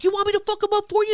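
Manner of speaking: high, fast, hyped-up offer; itching to fight for her; eager offer. Retold or imitated.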